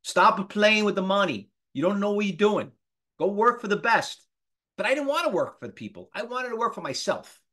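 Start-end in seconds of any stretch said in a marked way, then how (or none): 0.0-4.2 put-on Italian accent. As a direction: scold like an Italian immigrant dad. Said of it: "with this Italian accent".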